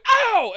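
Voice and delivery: sudden high yelp of mock pain; wincing ow at a brutal bump; retold ow. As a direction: yelp it as if you felt the hit. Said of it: "I went".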